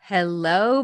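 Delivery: ordinary talk, nothing marked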